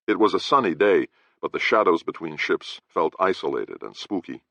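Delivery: ordinary delivery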